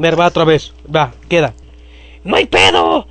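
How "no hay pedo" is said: put-on voice. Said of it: "me olvida la voz".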